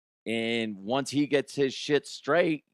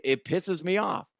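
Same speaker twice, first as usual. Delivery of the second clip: usual speaking voice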